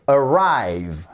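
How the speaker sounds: "Arrive" with the weight on the second syllabe, final e silent; stress on arrive. Shown as a demonstration. Teacher modelling "Arrive" for students, stress on second syllable. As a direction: lean on the "rive" of "Arrive". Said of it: "Accentuation sur, exactement, la seconde syllabe".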